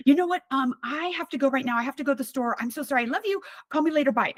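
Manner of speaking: high, bright, hurried mom voice brushing her off; imitating mom. Daughter imitating her mom hanging up on her Everest call, high and hurried. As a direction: rush the goodbye in a high chirpy voice.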